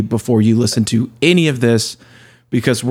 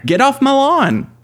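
high-pitched mock old-man scolding; cranky old man; 12 semitones higher than usual. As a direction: play the cranky old man chasing people away. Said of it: "old man [name] there".

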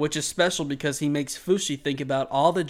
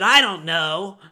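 mock curt customer; 5 semitones higher, 7 dB louder, slower than usual. Retold rude customer answering.

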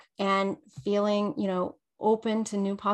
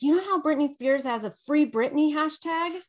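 excited outburst; 7 semitones higher, 4 dB louder than usual. Retold or imitated. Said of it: "I was like".

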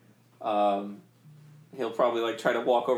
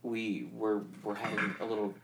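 ordinary talk

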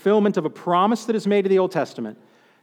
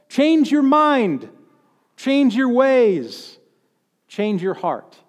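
prophetic proclamation; 5 semitones higher, much slower than usual. Retold or imitated. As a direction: proclaim each change slowly. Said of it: "he says".

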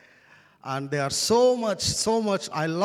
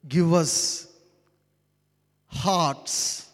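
solemn prayer; 3 semitones lower than usual. Acted. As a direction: pray it reverently, letting each phrase settle. Leads congregation in prayer.

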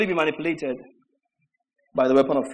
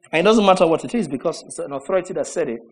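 normal talking voice

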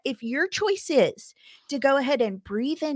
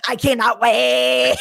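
cannot wait; 6 dB louder, slower than usual. Retold or imitated. Excited about friend's article.

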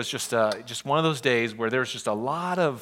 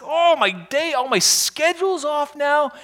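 higher, louder, slower lament; welling anger; 11 semitones higher, 5 dB louder than usual. Retold or imitated. Pastor moaning about his lost day, rising anger. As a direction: groan over the ruined day. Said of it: "welling up with anger".

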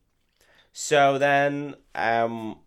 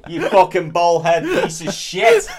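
laughing heckler shout; 7 semitones higher, 10 dB louder, slower than usual. Retold or imitated.

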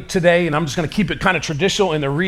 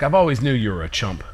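smug look; 7 semitones lower, 4 dB quieter than usual. Retold or imitated. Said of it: "he's got a look on his face like".

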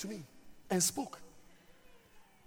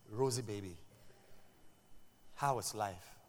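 mild tender; 10 semitones lower, 12 dB quieter than usual. Retold or imitated. Pastor texting woman.

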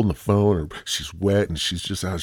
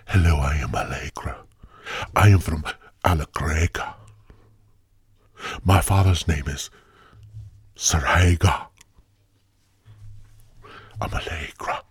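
whispery, affected foreign-sounding character; theatrical whispered self-introduction with halting pauses; mock Allegra introduction. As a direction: introduce yourself in a hammed-up exotic whisper. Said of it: "that calls herself Allegra".

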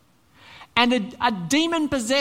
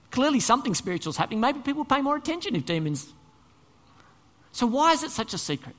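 ordinary talk

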